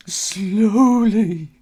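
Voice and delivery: slowly, high and loud, falling; slowly stretched with a high falling tune; drawn-out slowly. A narrator's reading. Suspense repetition.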